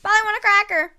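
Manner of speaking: high squawky parrot talk. Pretend. Parrot imitation.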